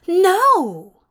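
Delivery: high, loud horrified refusal; crying out. A narrator's reading. Angel horrified by the whip, crying out. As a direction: cry out in protest. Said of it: "Zira cried".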